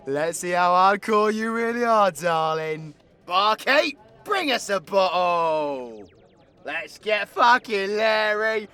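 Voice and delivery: loud, high bar-room holler; rowdy call to drink. Acted. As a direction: call across the pub for booze, drawing out the ends. Calling barkeep for a bottle.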